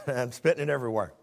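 talking around a mint; mouth full. Real, not acted. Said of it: "I've got a mint in my mouth".

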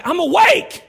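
high, loud yell; retold shout. Ranger yelling at the man he just knocked down. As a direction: bark it after the punch. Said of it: "I say".

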